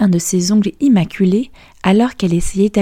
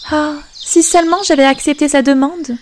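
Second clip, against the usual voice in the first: much higher, wistful princess sigh; a light touch of longing; sighing regret; 8 semitones higher than usual. Acted. Princess regretting the king's offer, higher and wistful. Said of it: "soupira-t-elle".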